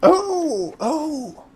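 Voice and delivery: high, sudden yelps of surprise; startled cries. Acted. Pam startled by Philinoia's greeting, high yelps. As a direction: jump at being hailed.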